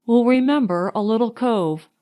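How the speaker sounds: plain reading voice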